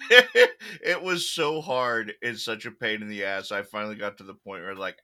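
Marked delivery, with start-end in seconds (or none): 0.0-3.5 laughing. Real, not acted. Laughing complaint.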